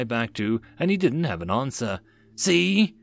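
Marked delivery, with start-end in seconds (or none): 2.3-3.0 high, mildly smug jab. A narrator's reading. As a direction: score the point smugly. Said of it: "the original speaker said smugly".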